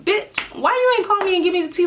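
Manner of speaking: high-pitched indignant retold demand; mock-livid, voice jumping way up. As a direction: call out a friend in outrage. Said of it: "I'm livid because my friend".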